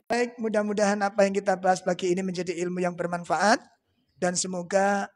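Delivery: usual speaking voice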